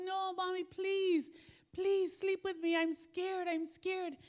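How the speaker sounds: put-on high, pleading little boy voice, slowing on scared. Little boy begging his mom during a thunderstorm, high and pleading. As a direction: beg like a frightened five-year-old.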